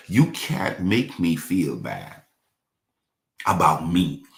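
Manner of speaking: ordinary talk, nothing marked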